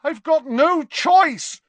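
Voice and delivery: high, loud, drawn-out cry; cornered boy crying out in desperation; desperate outburst. A narrator's reading. Malfoy pleading helplessly with Dumbledore, desperate.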